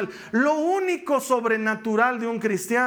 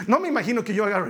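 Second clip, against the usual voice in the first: ordinary talk, nothing marked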